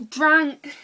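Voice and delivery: sudden loud, high yelp; loud outburst. Real, not acted. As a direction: blurt the word out loud. Loud high burst on one word.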